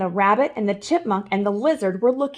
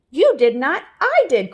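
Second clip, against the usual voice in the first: higher, louder retort; indignant cry; 6 semitones higher, 4 dB louder than usual. A narrator's reading. The chipmunk arguing back at the rabbit, indignant. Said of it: "cried the chipmunk".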